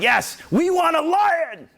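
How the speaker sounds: high, slow triumphant cry; rousing shout. Real, not acted. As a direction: shout it out like a rallying call. Shouted declaration over music.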